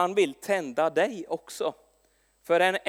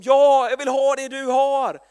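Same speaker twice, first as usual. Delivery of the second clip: eager colleague; 5 semitones higher, 8 dB louder than usual. Retold or imitated. Colleague eagerly wants what he has.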